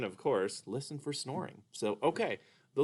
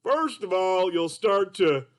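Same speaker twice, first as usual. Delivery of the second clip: high, slow cartoon puppet voice; Mister Dolphin puppet; 7 semitones higher, 5 dB louder, slower than usual. Puppet greets the kids as Mister Dolphin, high and slow. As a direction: play a sleepy, sing-song puppet. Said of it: "Mister Dolphin".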